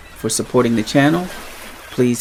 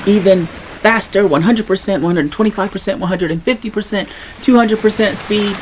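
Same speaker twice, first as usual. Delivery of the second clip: faster speech; 6 semitones higher than usual. Shown as a demonstration. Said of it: "at different speeds".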